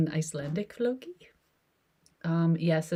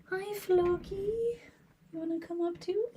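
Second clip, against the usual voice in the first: pet voice; 13 semitones higher than usual. Real, not acted. Owner inviting Floki up, in high baby talk. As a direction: coo up high to the cat.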